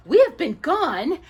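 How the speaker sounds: slow, loud eager witch. A narrator's reading. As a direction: announce it gleefully. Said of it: "she said eagerly".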